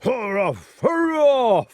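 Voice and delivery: bay like a questing hound; high hound-like barking; beast's bark. A narrator's reading. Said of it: "barked the questing beast in the far distance".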